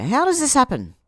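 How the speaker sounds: loud, high indignant question. Real, not acted. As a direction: demand it with outrage.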